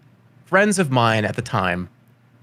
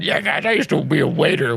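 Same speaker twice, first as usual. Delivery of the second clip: drunk imitation; 3 semitones higher than usual. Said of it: "Was I, like, shit-faced one day".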